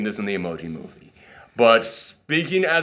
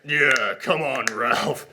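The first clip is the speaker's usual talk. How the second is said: slow, put-on bouncer voice; big bouncer; slower than usual. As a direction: lean on him like a heavy at the door. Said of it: "he becomes a big bouncer dude".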